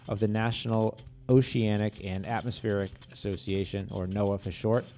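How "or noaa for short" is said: ordinary talk, nothing marked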